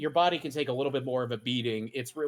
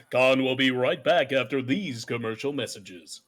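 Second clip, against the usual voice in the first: booming TV announcer read, louder; 5 dB louder than usual. Shown as a demonstration. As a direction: announce it like a commercial break. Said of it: "I'll do the announcer voice".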